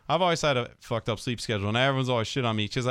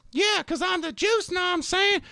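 imitating a cocky kid. Teacher mimics his student bragging, in a high kid voice. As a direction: pitch it way up like a swaggering student. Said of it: "He's like".

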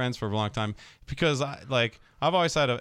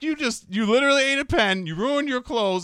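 mock scolding; 8 semitones higher, 4 dB louder than usual. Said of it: "I'm like".

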